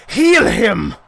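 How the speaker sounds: high, loud urgent order; shouted command. Acted. Fighter commands two healers, loud shout.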